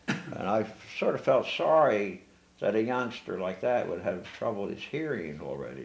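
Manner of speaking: ordinary delivery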